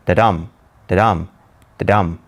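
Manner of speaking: low da-dum, weak then strong, with pauses between; heartbeat rhythm. Shown as a demonstration. Teacher showing the iamb pattern, low heartbeat da-DUM. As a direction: beat out each "da-dum" like a heart. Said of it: "like the heartbeat".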